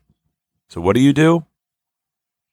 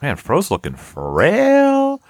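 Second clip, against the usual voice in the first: higher, slow, "frail" stretched out long; drawn-out teasing; 8 semitones higher, much slower than usual. Retold or imitated. Teasing friend about seeing him last week, drawn-out "frail". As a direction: drag out "frail" with mock concern.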